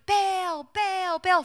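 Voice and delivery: high-pitched repeated calling; a mild mock shout, pitched well up; imitated shouting. Prophets calling on Baal for hours, mock shouting. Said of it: "So they're shouting".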